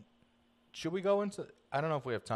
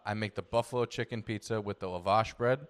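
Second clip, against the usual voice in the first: ordinary delivery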